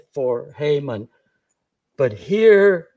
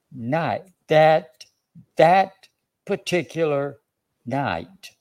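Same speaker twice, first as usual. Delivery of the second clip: THAT raised and punched, repeated, with pauses; emphatic stress on THAT; slower than usual. Shown as a demonstration. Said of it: "I want to emphasize the word".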